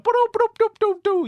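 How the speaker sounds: high, loud silly gunfire; mimicked gun noises. Josh's avatar waving his gun, playful gun noises. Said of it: "throwing his gun around, being like".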